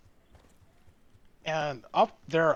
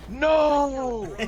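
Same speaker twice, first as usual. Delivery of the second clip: sudden high shout of no; yelling no; 10 semitones higher, 6 dB louder than usual. Retold or imitated. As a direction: bark it like an enraged customer. Angry customer yelling retold.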